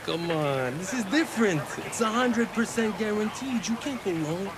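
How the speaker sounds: high, excited hustle; eager persuading. Acted. Tipster pushing a guaranteed bet, eager high-pitched pitch.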